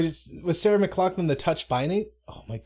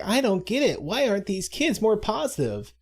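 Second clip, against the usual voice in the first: mock-baffled, higher-pitched questioning; baffled angel; 7 semitones higher than usual. Puzzled angels conferring at a kids' camp, acted higher and baffled. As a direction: play up the incomprehension.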